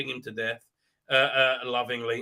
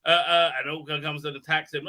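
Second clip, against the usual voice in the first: ordinary talk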